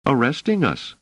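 high-pitched, grim woman's reply. A narrator's reading. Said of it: "said Doris grimly".

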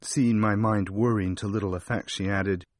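usual speaking voice

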